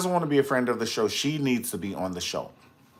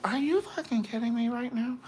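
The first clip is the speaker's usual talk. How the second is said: incredulous quote; 11 semitones higher, 5 dB quieter than usual. Retold or imitated. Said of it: "And you're like".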